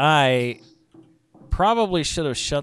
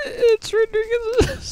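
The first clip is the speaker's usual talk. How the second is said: high, slow laughing cry; 4 dB louder, slower than usual. Real, not acted. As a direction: laugh it out helplessly. Laughing at the skirt.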